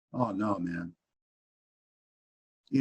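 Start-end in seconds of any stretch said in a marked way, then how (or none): 0.1-0.9 low, soft "oh, no, man"; subdued denial. Retold or imitated. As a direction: sink into a quiet, sincere reply. Acts his answer to inner voice.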